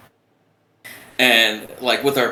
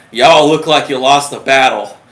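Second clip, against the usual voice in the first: loud Southern petty officer; thick Southern accent; 7 dB louder than usual. Retold or imitated. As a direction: deliver it as a loud Southern put-down. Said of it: "He's got the thickest Southern accent".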